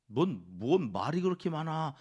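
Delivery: a friend cutting him off, annoyed; abrupt irritated retort. Retold or imitated. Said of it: "다짜고짜". (다짜고짜 짜증 내며 쏘아붙이는 말투)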